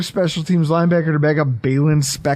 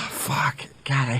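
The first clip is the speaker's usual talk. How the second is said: breathy whispered curse; 4 semitones lower, 8 dB quieter than usual. Real, not acted. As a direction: whisper it under your breath. Frustrated after losing the argument.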